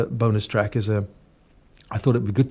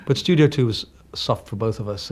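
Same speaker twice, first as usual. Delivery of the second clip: ordinary delivery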